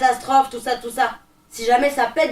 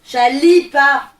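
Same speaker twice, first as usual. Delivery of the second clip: loud, slow CHALIPA, syllables stretched; drawn-out, insistent CHALIPA; indignant name correction; 7 dB louder, much slower than usual. Acted. Corrects the nickname chaloupe.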